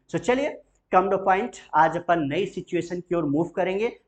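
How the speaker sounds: ordinary delivery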